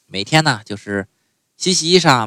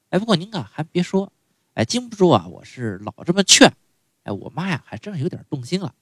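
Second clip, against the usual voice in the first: ordinary talk, nothing marked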